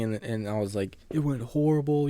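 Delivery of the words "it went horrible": much higher and louder, near-sobbing retelling; crying out. Retold or imitated. Boy telling his mom about the bad day, crying loudly. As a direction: let it break out loud and high like a sob. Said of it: "I'm crying".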